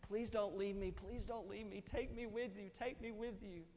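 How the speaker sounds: high pleading little boy's cry, lightly voiced; imitating crying boy. A hint of a child wailing please don't go. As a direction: pastor retells a four-year-old begging a leader, high mock sobbing plea. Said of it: "starts crying his eyes out".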